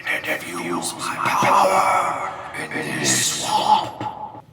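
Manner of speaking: slow, high, echoing spirit proclamation; booming spirit. Acted. Will-O-Wisp proclaims its power in the swamp, slow echoing. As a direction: declare vengeance like a swamp spirit.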